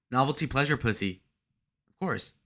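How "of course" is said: soft, offhand agreement; understated aside. Real, not acted. Streamer agreeing with his own joke, softly. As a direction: toss it off softly. Says it low, then shouts.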